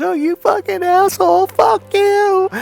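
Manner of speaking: very high mock screech; mock outraged woman. Embarrassed friend cursing the husband who insulted her, mock outraged. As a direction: imitate an insulted woman in a wildly high, strained voice. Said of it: "what happened was Patty got embarrassed".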